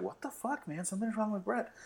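high, hushed retold worry; bewildered aside. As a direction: murmur it to yourself in disbelief. Bewildered aside after being rebuffed.

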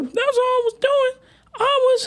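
very high, defensive plea; mock high girl voice. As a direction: squeak it like an excuse. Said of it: "She's like".

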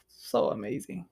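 soft, sighing awe; savouring. Retold or imitated. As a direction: sigh it out softly, savouring the smell. Savours the cologne's smell.

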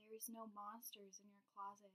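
soft, hushed storyteller voice; playful spooky voice. Acted. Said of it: "my serious, spooky voice".

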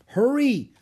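high loud call to hurry; urgent call. Acted. Annie calling Jack to hurry, loud high call. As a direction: hurry him along from inside.

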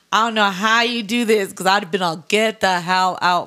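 loud, playful exasperated outburst; hammed-up loud complaint; mock exasperation. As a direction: pretend you're kicking noisy guests out. Imagines throwing noisy guests out.

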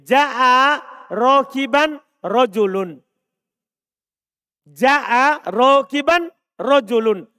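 slow, loud and deliberate, each word drawn out; slow example reading. Shown as a demonstration. Teacher reading an Arabic example sentence to students, slow and emphatic. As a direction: spell out "Ja'a rokiban rojulun" syllable by syllable for the class. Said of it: "Saya bilang begini".

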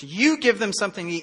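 You hit firmly as Jesus' command; stress on you. Retold or imitated. Jesus tells disciples to feed crowd, You stressed. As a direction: give You the weight of the line. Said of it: "You is emphatic here".

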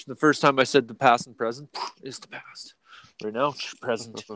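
unvoiced whisper; whispered aside. Real, not acted. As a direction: confide the point in a whisper. Whispered aside explaining joke.

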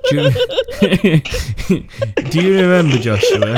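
words squeezed out through laughter; giggling while trying to talk; laughing. Real, not acted. Host cracking up mid-sentence.

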